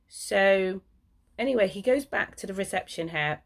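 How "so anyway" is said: usual speaking voice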